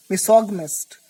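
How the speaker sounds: Misogynist said as mi-SOG-mist, hard g, stress on SOG; correct pronunciation. Shown as a demonstration. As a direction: squeeze Misogynist into three syllables, mi-SOG-mist. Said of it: "this is misogynist".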